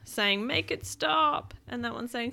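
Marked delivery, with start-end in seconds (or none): 0.5-1.5 lifted, drawn-out plea; lightly voiced suffering blimp; pleading blimp. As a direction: stretch out a comic complaint. Blimp pleads in fart cloud.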